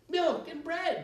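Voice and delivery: put-on high, loud children's chorus. A narrator's reading. Children chorusing their demand to the witch. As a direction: chant it like a crowd of kids. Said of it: "they chorused".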